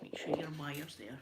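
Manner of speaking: whispered aside about the mic wire; hushed off-mic reminder. Real, not acted. Whispering to the crew about a wire.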